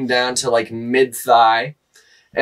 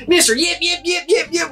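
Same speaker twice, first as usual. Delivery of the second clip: high squeaky mock yelping of a name, much higher; imitating the girl. Retelling the umbrella girl who yelped at him, high mock squeal. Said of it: "takes her umbrella and goes".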